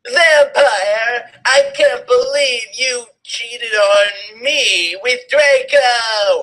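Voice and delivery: high, slow, hammed-up accusation; mock shout. A narrator's reading. Girlfriend accusing her cheating boyfriend, high mock shout. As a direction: shriek the betrayal, drawn out. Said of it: "I shouted at him".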